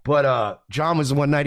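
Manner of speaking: usual speaking voice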